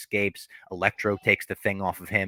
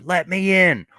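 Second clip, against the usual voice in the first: loud mock yell, higher; retold scream; 11 semitones higher, 7 dB louder, slower than usual. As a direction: belt it like the angry old man at the bank. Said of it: "he just fucking screams at them".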